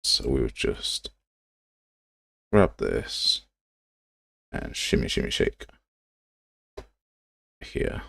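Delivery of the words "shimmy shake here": ordinary talk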